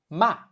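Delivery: MA starting high and dropping sharply; fourth tone. Shown as a demonstration. Teacher giving the word for scold, MA in a falling fourth tone. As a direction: drop MA like a short command. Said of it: "starts high and drops sharply".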